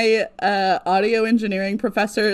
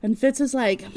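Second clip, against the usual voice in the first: normal talking voice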